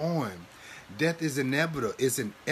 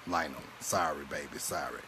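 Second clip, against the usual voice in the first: soft apology; 10 semitones lower, 7 dB quieter than usual. Real, not acted. Apologizing to a viewer.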